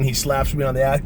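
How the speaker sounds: plain reading voice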